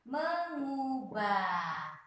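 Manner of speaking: "mengubah" drawn out very slowly, me-ngu-bah; slow syllable reading. Shown as a demonstration. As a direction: spell out "mengubah" slowly for pupils. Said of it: "Jadi bacanya harus ngu". (ucapkan "mengubah" sangat pelan, per suku kata)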